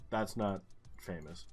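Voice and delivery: ordinary delivery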